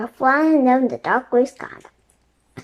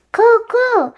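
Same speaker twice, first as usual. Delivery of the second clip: high, loud cooing like a pigeon; a loud, high birdlike coo; loud bird call; 10 semitones higher, 6 dB louder than usual. A narrator's reading. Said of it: "Teddy said loudly".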